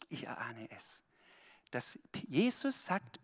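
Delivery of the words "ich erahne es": whispered, voiceless and hushed; hushed confiding whisper. Real, not acted. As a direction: drop to a secret whisper for Ich erahne es. Repeats line as a whisper.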